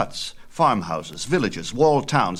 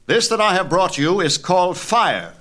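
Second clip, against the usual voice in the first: much higher, eager voice of a bold titan; a fiery titan excitedly presenting his gift; Prometheus teaching fire; 10 semitones higher, 6 dB louder than usual. Acted. Prometheus speaks to early men.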